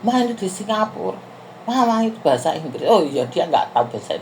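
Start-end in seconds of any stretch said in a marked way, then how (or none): 0.0-2.8 higher, mock child's reminder; child nudging mum. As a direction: daughter nudges her mother to speak English. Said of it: "anakku langsung senggol aku". (menirukan anak mengingatkan ibunya)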